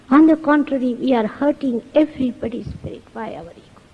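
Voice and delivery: ordinary talk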